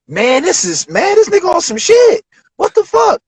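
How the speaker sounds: blown away by the beat; amazed disbelief. Retold or imitated. Rapper stunned by a friend's beat, amazed.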